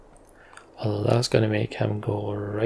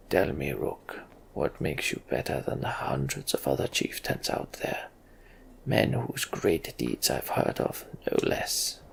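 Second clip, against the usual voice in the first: low, breathy, guarded king; suspicious king; 9 semitones lower, 5 dB quieter than usual. Acted. King questions Rook, low and suspicious. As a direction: weigh the stranger with distrust. King Meinolf voiced in dialogue.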